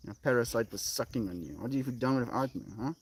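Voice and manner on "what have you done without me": normal talking voice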